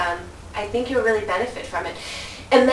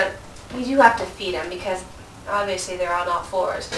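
ordinary talk

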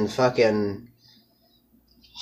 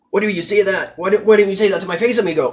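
higher, fast, pushy homie challenge; mock tough taunt; 7 semitones higher, faster than usual. Narrator challenges the rival to say it to his face. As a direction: get in his face, cocky.

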